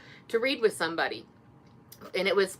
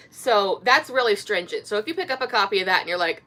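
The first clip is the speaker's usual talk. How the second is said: normal talking voice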